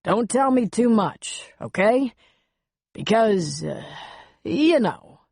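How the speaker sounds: put-on awkward, halting older man; hint of awkward older man. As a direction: hesitate through an uncomfortable warning. Voices older man Buck.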